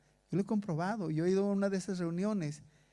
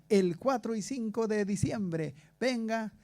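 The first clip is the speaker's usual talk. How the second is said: higher-pitched mock church advert; radio announcer imitation; 4 semitones higher than usual. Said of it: "Y decía". (imitando un anuncio de radio, voz más aguda)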